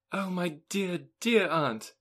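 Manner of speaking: rapturous cry. Acted. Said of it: "she rapturously cried".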